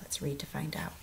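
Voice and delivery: whispered suspense. Real, not acted. Teacher hushing the class before reading on, whispered. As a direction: lean in and whisper it.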